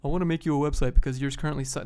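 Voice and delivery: a hint of a pushy salesman; blunt cold caller. Freelancer cold-calls a business, a touch of pushy salesman. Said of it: "Because if you're like".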